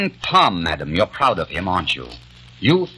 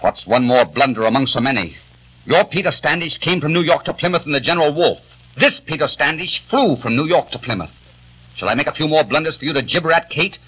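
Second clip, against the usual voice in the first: scornful taunt; 8 semitones higher than usual. Acted. Peter taunts Kate about his blunders, high and scornful. Peter mocks Kate's suspicions.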